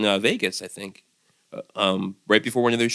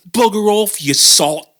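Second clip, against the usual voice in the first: put-on British accent; 10 semitones higher, 7 dB louder, slower than usual. Replies in British banter.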